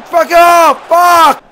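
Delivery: loud, high angry swearing; frustrated shout. Real, not acted. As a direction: blurt it out in frustration. Shot misses, frustrated outburst.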